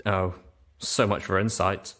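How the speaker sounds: usual speaking voice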